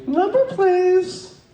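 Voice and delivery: high, drawn-out switchboard operator voice; put-on old-time operator, high and slow; telephone operator. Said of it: "and they would say".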